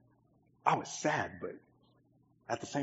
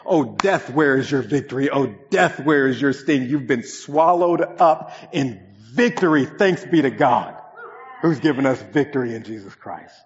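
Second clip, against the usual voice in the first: louder, slow triumphant proclamation; preaching; 4 semitones higher, 5 dB louder than usual. Acted. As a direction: proclaim the victory over death. Preaching climax scripture proclamation.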